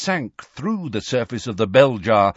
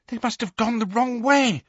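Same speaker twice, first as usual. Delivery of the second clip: high, fearful stage whisper; terrified whisper; 11 semitones higher than usual. A narrator's reading. Hermione realising the others are lost, terrified whisper. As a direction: whisper it high, full of dread. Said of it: "whispered Hermione".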